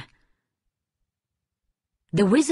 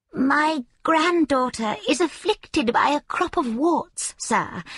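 put-on higher, slow voice of an old peasant woman; 7 semitones higher than usual. A narrator's reading. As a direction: plead like a humble old village woman. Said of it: "a peasant woman knocked".

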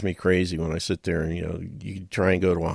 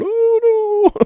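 much higher put-on whimpering cry; Kramer's wail; 7 dB louder than usual. Kramer dragged down by the crowd, much higher and louder. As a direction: squeal it as he's pulled down. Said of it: "Kramer with his little hand in the air going".

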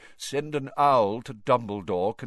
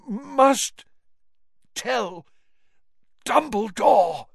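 high, slow, pushing each word out; strained effort; 12 semitones higher, 7 dB louder, much slower than usual. Acted. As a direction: gasp it word by word. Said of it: "seemed to cost him a terrible effort".

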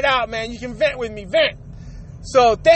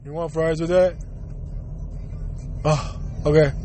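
much lower, put-on sullen worker voice; rude fast food worker; 9 semitones lower, 4 dB quieter than usual. Mocking a Burger King worker asking about fries, low and sullen. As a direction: drone it like a sulky cashier. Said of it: "and hear this".

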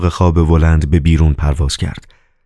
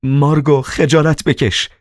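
higher, anguished plea to stop; pained cry; 9 semitones higher than usual. Acted. The Master begs Margarita to stop the fight. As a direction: cry out in distress at her.

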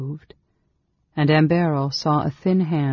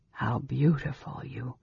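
much softer, trailing off; soft breaking off; 7 dB quieter than usual. A narrator's reading. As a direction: let it fade, overwhelmed. Breaks off, softly.

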